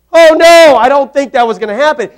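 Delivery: loud, high "Oh, no" of disbelief; emphatic no. Real, not acted. Preacher dismisses the idea, loud emphatic no.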